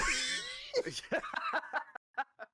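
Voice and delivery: long high-pitched laugh; laughing fit. Real, not acted. Host cracking up before the drinking story, high laughing fit. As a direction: burst into a long, squealing laugh.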